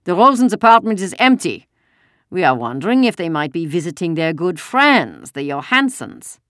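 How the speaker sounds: loud, high German soldier, slowing at the end; a second soldier fishing with false politeness. Acted. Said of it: "Another German voice said".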